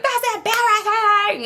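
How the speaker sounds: high, slow, drawn-out complaint; slow high-pitched mock scolding; high scolding whine. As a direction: stretch it out high like a whiny scold. Retold scolding boyfriend.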